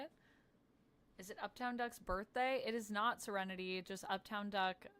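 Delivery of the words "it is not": usual speaking voice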